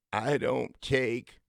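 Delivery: slow, drawn-out coach voice; slow deliberate coach. Retold or imitated. Coach opening a team meeting, slow and deliberate. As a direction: draw each word out like the old coach. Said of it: "this is how he talked".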